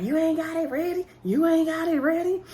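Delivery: high-pitched needling question; mock taunt. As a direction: imitate a taunting question twice, pitched well up. Retold taunt over phones.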